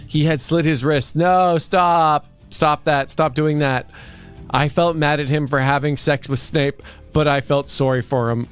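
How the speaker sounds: normal talking voice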